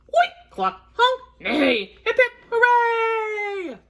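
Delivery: high farm noises into a long hooray; animal-noise cheer. Acted. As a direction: whoop through oinks and clucks to a stretched cheer.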